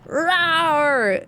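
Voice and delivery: dinosaur voice. Acted. Answers the roars in kind.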